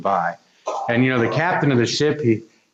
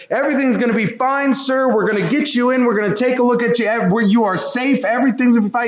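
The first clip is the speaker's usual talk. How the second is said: higher, fast, urgent rescue reassurance; eager reassuring rescuer; 11 semitones higher, faster than usual. Retold or imitated. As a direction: rush in with comfort like a rescuer. Helicopter rescuer reassuring man.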